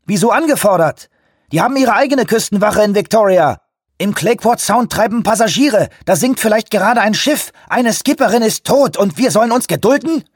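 high, loud, outraged tirade; furious caller demanding help; shouting into phone. A narrator's reading. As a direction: yell your outrage down the line. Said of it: "schrie Shoemaker ins Telefon".

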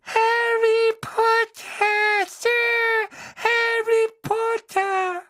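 very high, slow, loud squeak; a tiny house-elf squeaking with joy; squeaky elf voice. Acted. House-elf greeting Harry in the kitchen, joyful squeak.

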